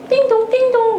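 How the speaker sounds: high sing-song ding-dong; doorbell imitation. Mimicking the doorbell at the newlyweds' door, high and sing-song. Doorbell rings on weekend morning.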